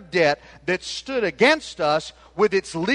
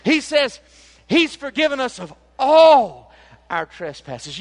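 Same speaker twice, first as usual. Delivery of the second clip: preaching at full force; 7 semitones higher, 9 dB louder than usual. Acted. Preacher surges again.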